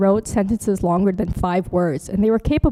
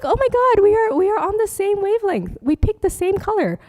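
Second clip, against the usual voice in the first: much higher, thrilled squeal of delight; gushing excitement; 11 semitones higher than usual. Retold or imitated. Teacher retelling her joy at matching slide colors with a colleague, high gushing. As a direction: gush like a fan finding a match.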